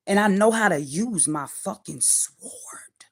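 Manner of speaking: slow breathy threat, whispered; menacing whisper. Real, not acted. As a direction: whisper it slowly like a warning. Menacing boast after naming her voice.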